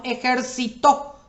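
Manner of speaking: ejercitó with the final TÓ stressed; ejercitó, voice loaded onto TÓ; stress on last syllable. Shown as a demonstration. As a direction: land on the last syllable: e-jer-ci-TÓ. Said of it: "lleva tilde en la última sílaba".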